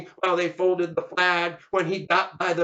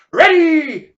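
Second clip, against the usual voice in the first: high, long-held firing call; yelled command; 9 semitones higher, 10 dB louder than usual. Acted. Wayburn to the gunmen, yelled order. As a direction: yell the order down the line.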